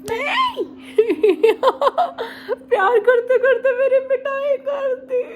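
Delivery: high, loud laughing yelp; laughing shout. Real, not acted. Baby hit her, laughing.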